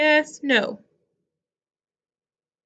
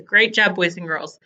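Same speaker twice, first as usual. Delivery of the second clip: normal talking voice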